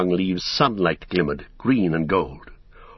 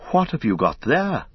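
higher-pitched, eager question; excited cry; 5 semitones higher than usual. A narrator's reading. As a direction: cry out with curious wonder. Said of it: "Frodo cried".

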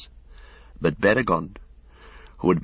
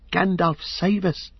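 much higher pleading cry, fading on save us; desperate plea; 10 semitones higher, slower than usual. A narrator's reading. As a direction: beg to be saved. Pippin calls for rescue.

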